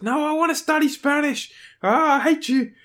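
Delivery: a grown man wailing as he's dragged out; Buddy kicking and screaming. Retold or imitated. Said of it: "kicking and screaming".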